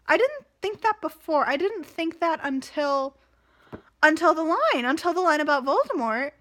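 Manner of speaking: loud, eager gloating about always knowing; excited. Real, not acted. Reader gloating over the villain twist, excitedly. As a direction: pour it out, vindicated. Gleeful rant about disliking her.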